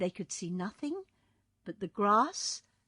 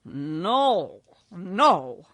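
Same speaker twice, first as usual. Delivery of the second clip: firm, louder no; firm refusal; 10 dB louder than usual. A narrator's reading. Wizard refusing Dorothy's plan, firm. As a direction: shut the idea down. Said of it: "said the wizard positively".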